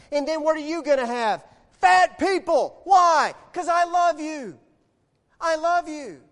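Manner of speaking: loud, high, impassioned sermon delivery; full-force preaching. Acted. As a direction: drive each phrase home to the congregation.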